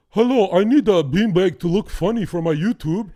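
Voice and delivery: loud, high put-on foreign accent; [name] in mock immigrant accent. Mocking a YouTuber buying a beanbag, loud put-on accent. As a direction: ham up a stilted immigrant-American accent. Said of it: "Why you give him that accent".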